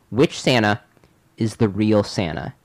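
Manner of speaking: ordinary talk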